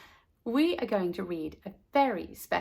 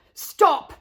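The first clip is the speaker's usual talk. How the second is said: loud high shout of an angry gardener; furious yelled warning; calling out; 11 semitones higher, 12 dB louder than usual. Acted. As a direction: bellow it across the garden. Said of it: "calling out".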